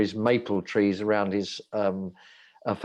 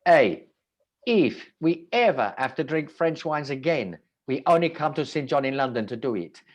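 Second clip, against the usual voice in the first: put-on Italian accent; 4 semitones higher than usual. Retelling the Italian winemakers' letter, Italian accent. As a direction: boom out a grateful Italian winemaker's toast. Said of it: "this is an Italian accent".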